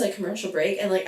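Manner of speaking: ordinary talk, nothing marked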